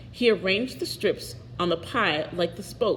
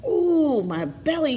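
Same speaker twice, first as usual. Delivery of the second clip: high pained wail over his belly; a touch of a pained wail; 8 semitones higher, 3 dB louder, slower than usual. A narrator's reading. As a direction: moan it out like a hurting father. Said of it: "he wailed".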